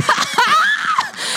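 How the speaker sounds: very high, wailing laugh; crying laughter. Retold or imitated. Speaker reliving breakthrough from within, crying and laughing. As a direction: shriek with tearful laughter. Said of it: "laughing and then crying, laughing".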